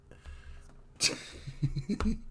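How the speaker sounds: high unvoiced burst of laughter. Real, not acted. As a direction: cackle at your own joke. Laughs at own insult.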